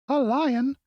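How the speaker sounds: very high startled squeak; alarmed mouse queen. Acted. Mouse queen hearing of the lion, alarmed squeak. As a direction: cry out in alarm. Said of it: "cried the little Queen".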